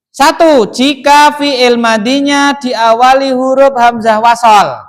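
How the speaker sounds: loud, measured dictation; rule read-out. Shown as a demonstration. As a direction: proclaim the rule slowly for the class. Reads out the rule.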